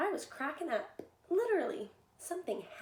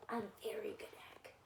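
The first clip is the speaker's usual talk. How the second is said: hushed boastful whisper; 6 dB quieter, faster than usual. Acted. Egg whispering to the children that he was very good. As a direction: whisper it like a proud secret.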